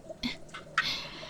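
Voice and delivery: breathy little laugh; quiet unvoiced giggle; soft whispered chuckle. Monster chef chuckles softly.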